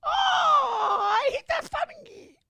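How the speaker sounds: very high mock anime squeal. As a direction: squeal it like a cartoon girl.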